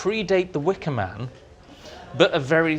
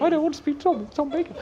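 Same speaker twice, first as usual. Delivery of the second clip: much higher, starstruck squeak; mock-squeaky nervous voice; squeaky panic; 12 semitones higher, faster than usual. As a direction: squeak it out like a flustered fan. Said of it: "making inarticulate squeaks".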